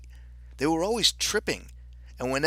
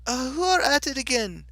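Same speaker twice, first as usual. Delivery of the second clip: high, wavering king's question; 12 semitones higher than usual. Acted. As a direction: ask it, taken aback. King answers the deafening shout.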